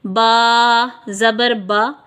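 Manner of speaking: बा ज़बर बा said slowly, each syllable held clear; syllable drill, mouth open on बा; slow drilled reading. Shown as a demonstration. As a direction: spell it out slowly for the learner. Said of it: "अब आप पढ़िए".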